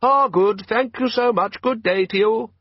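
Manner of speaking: a small metal thing asking the way politely; tinny polite voice. A narrator's reading. Sea creature politely asking a raft for directions, tinny voice.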